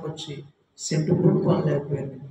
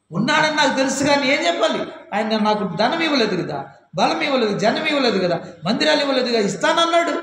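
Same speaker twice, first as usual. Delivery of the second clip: raised, reproachful grumble at God; complaining; 8 semitones higher, 4 dB louder than usual. Retold or imitated. Pastor grumbling against God, reproachful raised voice. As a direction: whine the grievance up high. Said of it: "నిష్ఠూరంగా మాట్లాడు".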